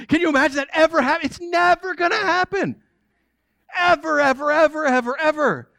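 fast, high, insisting; high incredulous. Real, not acted. Preacher to congregation, pitched high in disbelief. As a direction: pile up the evers in disbelief.

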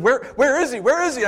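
high, loud urgent asking; eager searching. Acted. Thomas searching the house, high eager call. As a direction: call out hoping to find him.

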